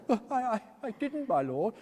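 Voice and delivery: startled timid reply; nervous young Winkle. A narrator's reading. Winkle flustered.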